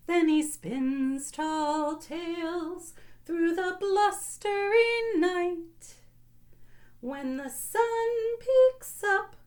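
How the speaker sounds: put-on lilting sing-song read to kids; sing-song picture book reading; storybook read-aloud. A narrator's reading. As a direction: read it like bedtime story time, half singing.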